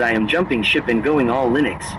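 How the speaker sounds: ordinary talk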